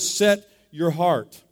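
slow, spaced-out phrase; call-and-repeat phrase. Shown as a demonstration. Preacher leading everybody in saying the words, slowly. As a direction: draw it out for others to repeat. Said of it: "Everybody say those words".

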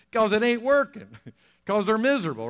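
high, loud laughing aside; preacher laughing as he explains why people are mean. Real, not acted.

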